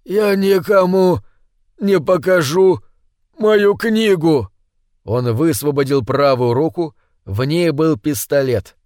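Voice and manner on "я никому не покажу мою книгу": slow, higher, forced out between gasps; gasping for air, squeezing out each word. Acted. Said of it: "Хватая ртом воздух, он выдавил едва понятные слова".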